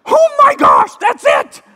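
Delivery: high, loud exclaimed discovery; mock shout of realization. Realizing the meaning, a loud high shout. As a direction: shout it as if it just clicked. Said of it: "I didn't do it like that".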